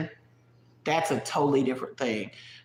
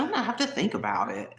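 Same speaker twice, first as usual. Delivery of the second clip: put-on higher, prim Hillary Banks type accent on "I'm gonna have to think about it."; the girlfriend's hesitant reply in a Hillary Banks accent; 5 semitones higher than usual. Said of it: "that little Hillary Banks type accent".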